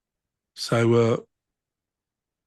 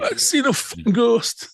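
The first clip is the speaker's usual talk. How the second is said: mock drunk tourist; 10 semitones higher, 3 dB louder, slower than usual. Mock drunk visitor quote.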